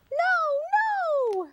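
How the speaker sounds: very high, loud squeal; piglet squeal. Acted. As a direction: shriek no in panic. Piglet protests in story.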